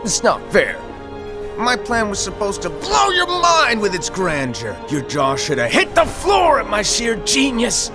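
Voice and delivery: high, theatrical tantrum; hammed-up wounded pride; indignant whining. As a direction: complain grandly, voice leaping up on the boasts. Master whining over spoiled grand reveal.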